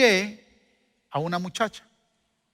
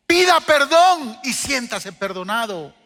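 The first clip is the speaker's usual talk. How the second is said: preaching exhortation; 6 semitones higher, 5 dB louder, slower than usual. Real, not acted. Preaching climax.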